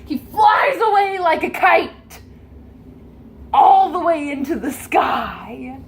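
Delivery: loud, high cry as he flies off; thrilled storyteller yelling; excited shout. A narrator's reading. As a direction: shout it like a kite zooming away. GM cries out as he flies.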